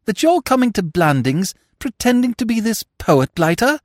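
high-pitched incredulous demand; high-voiced astonished young fool; incredulous Freddy. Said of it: "he demanded".

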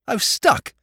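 a helpless lordly whine; plaintive. A narrator's reading. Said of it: "a plaintive voice".

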